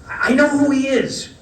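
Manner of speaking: high, loud sudden exclamation; live on air, caught off guard, a high loud exclamation; excited blurt. Retold or imitated. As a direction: blurt it out in the rush of recognition.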